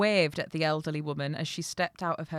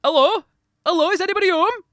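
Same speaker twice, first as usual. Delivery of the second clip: high, loud Yorkshire call; shouting; 15 dB louder, faster than usual. A narrator's reading. Lost traveller calling to a house, shouting. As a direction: holler out for whoever is inside. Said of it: "He walked towards the house shouting".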